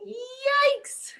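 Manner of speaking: high, startled scream; storyteller's high-pitched yelp of horror. A narrator's reading. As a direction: shriek it in alarm. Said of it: "scream Old Woman and all the animals".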